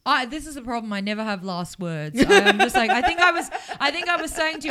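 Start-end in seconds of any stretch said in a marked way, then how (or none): 2.3-4.7 laughing. Real, not acted. Laughs about never having last words.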